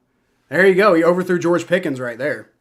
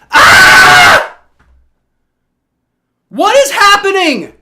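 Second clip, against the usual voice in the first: high, loud screams of disbelief; furious outraged yelling; 11 dB louder, slower than usual. Real, not acted. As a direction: shriek at the bad call, in real fury. Fumble call goes against team.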